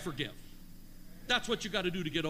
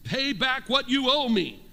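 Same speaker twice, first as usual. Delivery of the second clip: loud, high, slow demand; 5 semitones higher, 7 dB louder, slower than usual. A narrator's reading. Unforgiving servant to the man who owes him, demanding. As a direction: order repayment forcefully, word by word. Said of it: "he demanded".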